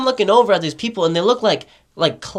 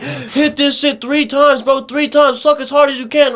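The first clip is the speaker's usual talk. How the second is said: raver dealer voice; 5 semitones higher than usual. Retold or imitated. Dealer pushing vape on narrator.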